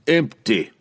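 loud, firm answer. A narrator's reading. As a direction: state it firmly. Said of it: "Wulfgar answered firmly".

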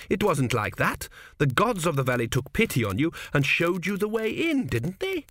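gently begging to be told it's true; pleading. A narrator's reading. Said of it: "surprised at the tones of pleading in his own voice".

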